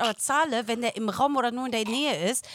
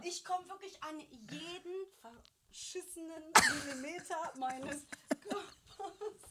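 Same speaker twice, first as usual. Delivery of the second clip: slow, very quiet, strained voice with a laugh; words dragged out softly mid-stretch; straining to reach; 4 semitones higher, 13 dB quieter, slower than usual. Real, not acted. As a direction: talk while reaching round your own back. Strained while demonstrating her reach.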